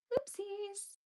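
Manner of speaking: ordinary talk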